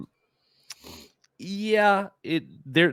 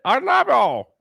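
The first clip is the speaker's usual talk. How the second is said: high mock love plea; 14 semitones higher, 5 dB louder than usual. Mimicking the love plea again.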